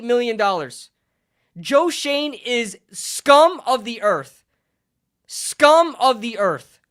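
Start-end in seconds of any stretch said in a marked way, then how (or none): 1.6-6.8 slow, loud, emphatic insult; angry condemnation. Real, not acted. Fan ranting at the Giants' general manager, angry condemnation. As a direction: spit out the verdict word by word, slowly.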